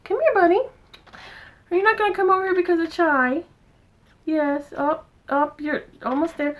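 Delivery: high coaxing pet talk; high-pitched encouragement to the cat. Real, not acted. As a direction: coax him gently over.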